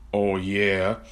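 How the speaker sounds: ordinary delivery, nothing marked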